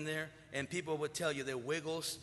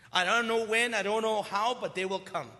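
loud; 4 semitones higher, 7 dB louder than usual. Real, not acted. Preacher building to emphatic peak.

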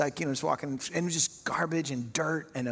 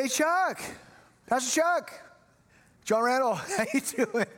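high, eager hail to someone passing; eager calling out; 9 semitones higher than usual. Retold or imitated. Young janitor greeting the passing pastor, eager calling out. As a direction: call out high, hoping to be noticed.

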